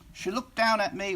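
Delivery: usual speaking voice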